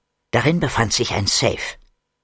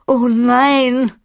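high, loud horrified gasp of words; shocked cry; 11 semitones higher, 5 dB louder than usual. Acted. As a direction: cry out in dismay. Abigail shocked. (Abigail sieht Nelson entsetzt an, hoch und laut)

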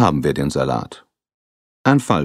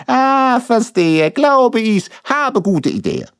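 put-on much higher, louder bubbly Italian accent; Italian-accented Ricardo; 5 dB louder, slower than usual. Grinning Ricardo to the detectives, excited in an Italian accent. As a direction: beam with sudden inspiration in an Italian accent.

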